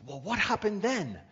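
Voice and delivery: lifted high in mock amazement; high, eager mock wonder; amazed. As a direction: play the friends' wide-eyed curiosity. Amazed men ask the third man.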